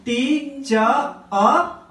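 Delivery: "टी च अ" in separate, very slow syllables, ending on an open "uh"; drawn-out final vowel. Shown as a demonstration. Teacher showing class the pronunciation, syllable by syllable. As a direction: sound out "टी च अ" piece by piece, no R. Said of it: "बोल के थोड़ा सा खींचेंगे".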